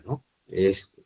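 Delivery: ordinary talk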